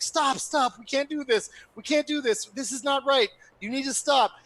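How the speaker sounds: high, pleading official trying to stop the crowd; panicked plea to stop the chaos; frantic official. Retold or imitated. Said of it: "in charge of public health is like".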